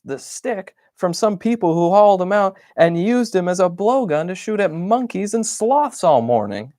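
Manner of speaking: a fed-up bunny venting; frazzled complaint rising to a cry; exasperated rant. Acted. Upset diary entry, voiced character.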